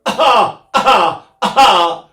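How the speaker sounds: loud, high coughed "ah"s; full-blast cough. Shown as a demonstration. Singer showing what [name] avoided, full-blast coughed "ah". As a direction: punch out hard cough-like "ah" bursts. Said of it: "not a full blast one".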